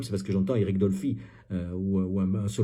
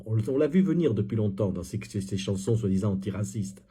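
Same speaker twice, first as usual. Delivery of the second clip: normal talking voice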